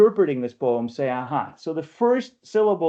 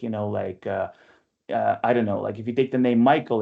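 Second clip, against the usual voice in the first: normal talking voice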